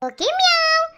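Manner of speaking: high cooing goodnight; pillow talk. Acted. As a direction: sing it out sweetly, flirting. Sweet goodnight to the guest. (கொஞ்சும் குரலில் good night)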